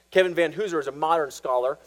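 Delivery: ordinary talk, nothing marked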